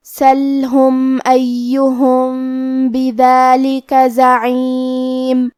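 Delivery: slow melodic tajweed chant; measured Quranic recitation. Shown as a demonstration. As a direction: recite the verses slowly with long held vowels. Recites the verses.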